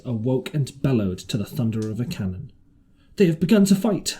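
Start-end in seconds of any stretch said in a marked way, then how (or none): 3.1-4.2 high, loud alarm; alarmed cry. A narrator's reading. Jim crying out as the cannon booms. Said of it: "I cried".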